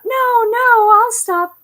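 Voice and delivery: put-on high, loud little-girl pleading; mock child promising to stop; pleading daughter. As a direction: beg like a kid caught out. Daughter begging in retold story.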